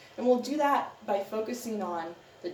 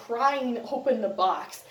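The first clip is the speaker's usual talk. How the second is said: usual speaking voice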